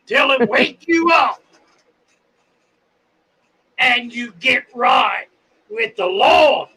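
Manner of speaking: high, slow, shouted sermon; fire and brimstone preaching. Pretend. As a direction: thunder it from the pulpit. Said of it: "Bible thumping, pulpit beating, fire and damnation".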